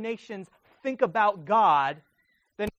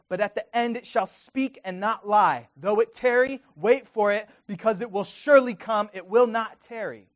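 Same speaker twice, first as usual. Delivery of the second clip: solemn reading that swells louder to the end; scripture reading building up; 2 semitones higher, 4 dB louder than usual. Acted. Preacher proclaiming God's answer to Habakkuk, building louder. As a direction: build the promise until it rings out.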